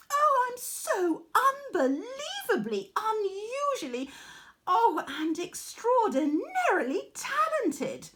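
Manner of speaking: preen over every word like a spoiled diva; arrogant boast. Acted. Arachne boasting to her mother, high and vain. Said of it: "she remarked one day to her mother".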